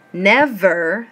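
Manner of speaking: "Never" drawn out in two separate beats, NEV-ER; syllable by syllable. Shown as a demonstration. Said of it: "break down the syllables".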